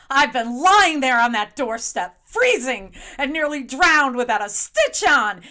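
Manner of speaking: loud indignant scolding wife; angry scolding. Acted. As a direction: tear into him, loud and fed up. Wife scolds husband at the door.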